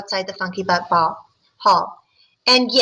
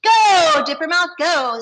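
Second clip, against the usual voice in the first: called out; 7 semitones higher, 5 dB louder, slower than usual. Acted. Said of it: "someone called".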